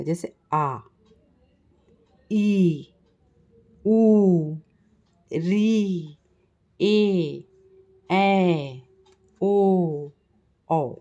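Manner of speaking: each long vowel held for two beats. Shown as a demonstration. Teacher showing the class long vowels, held out. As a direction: stretch every vowel to twice the length. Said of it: "दो गुना time लगता है हमको दीर्घ स्वर का उच्चारण".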